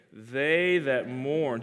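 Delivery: very slow, each word stretched; slow, drawn-out phrase. Shown as a demonstration. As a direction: lead the line slowly for all to repeat. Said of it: "you say that with me".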